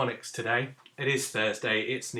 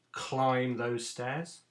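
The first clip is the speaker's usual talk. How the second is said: modelled command; 2 semitones higher, much slower than usual. Shown as a demonstration. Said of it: "A command".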